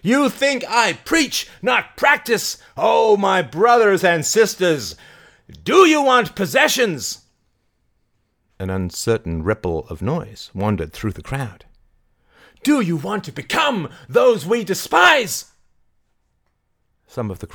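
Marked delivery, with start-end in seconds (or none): 0.0-7.2 high, slow rhetorical questions; shouted challenge. Acted. Mary challenging her brothers and sisters, high shouted appeal. 12.6-15.4 high, rousing question; shouted challenge. Acted. Mary goading the uncertain crowd, high shouted question.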